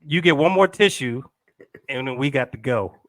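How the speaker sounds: loud, firm, half-joking ultimatum; stern ultimatum. Retold or imitated. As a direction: lay down the law, laughing. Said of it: "I'm like".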